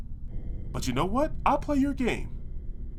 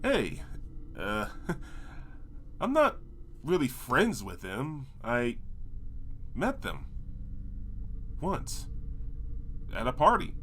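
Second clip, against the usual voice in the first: nervous mumble; 6 semitones lower, 8 dB quieter than usual. Acted. Nervous quiet excuse.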